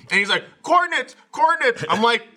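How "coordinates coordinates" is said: high-pitched repeated demand; mock frantic demand. Mimicking Andy asking for coordinates in a game, high frantic mock demand. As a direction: imitate a teammate calling out urgently.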